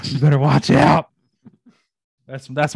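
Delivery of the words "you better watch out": loud, higher-pitched playful warning; mock warning. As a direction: call out a teasing warning. Mock warning about a prediction.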